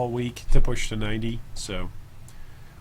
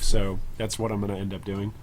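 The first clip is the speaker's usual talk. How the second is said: normal talking voice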